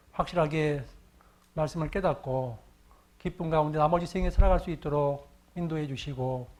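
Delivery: low, even prayer voice. Acted. Pastor leads the congregation in a closing prayer, low and reverent. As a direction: pray calmly and reverently, slowing at the end.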